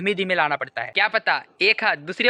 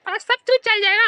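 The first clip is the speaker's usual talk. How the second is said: ordinary talk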